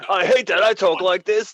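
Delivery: high, loud mock English accent. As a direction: complain in a hammed-up English accent. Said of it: "they don't even like that".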